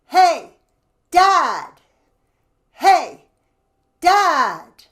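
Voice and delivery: shouting across the house; calling out. Shown as a demonstration. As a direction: call out loud from the belly. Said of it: "let's call to Dad".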